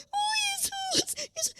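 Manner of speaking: high, soft, tearful cry to Jesus. Retold or imitated. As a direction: call out through tears. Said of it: "Saya bilang".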